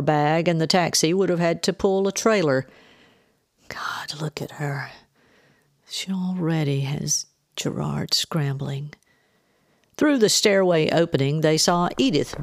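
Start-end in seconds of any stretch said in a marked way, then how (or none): 3.7-8.9 soft, slowed remark under the breath; hushed aside. A narrator's reading. Jennifer watching her mother arrive, hushed aside.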